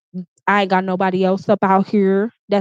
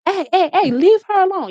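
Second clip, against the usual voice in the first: mock scream; 10 semitones higher than usual. Female driver telling him to leave her alone, high mock yell. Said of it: "started screaming at him".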